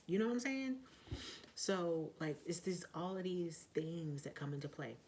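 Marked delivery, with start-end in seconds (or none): none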